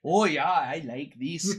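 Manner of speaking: put-on loud, slow Swedish-Canadian accent; hammed-up Swedish-Canadian accent, savoring it; Credence. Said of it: "I'm good old Swedish Canadian".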